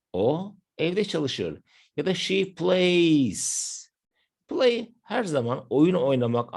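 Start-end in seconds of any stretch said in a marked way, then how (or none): 2.1-3.7 "she plays" slowly drawn out, leaning on the ending of "plays"; final S emphasised. Shown as a demonstration. Teacher giving another third-person example, "plays" drawn out to show the s. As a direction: stretch "plays" right through to its final s. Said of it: "Buraya da bir S ekleyelim".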